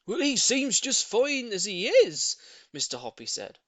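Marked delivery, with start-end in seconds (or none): 0.1-2.4 slow, high, loud reassurance; calm her down kindly; soothing neighbour. A narrator's reading. Neighbour reassuring the lady, slow loud comfort. Mr Hoppy voiced.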